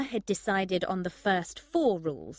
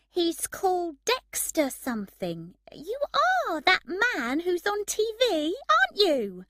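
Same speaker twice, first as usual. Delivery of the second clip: put-on high-pitched, starstruck girl; rising excitement on spotting someone famous; excited girl; 12 semitones higher, 4 dB louder than usual. A narrator's reading. Girl asks the TV star who he is, excited.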